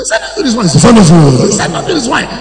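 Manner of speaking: loud overlapping shouted pleas; many demons clamoring. Acted. Preacher voicing demons all talking through one mouth, shouting. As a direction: yell it like a crowd of spirits at once. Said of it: "They go".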